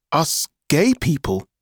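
high, stunned question; disbelieving echo; shocked. Acted. Dante stunned by revelation.

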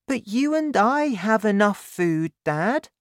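put-on high child's voice; high-pitched childlike protest; protesting boy. A narrator's reading. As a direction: object to Dad as a young boy. Boy objects to his father.

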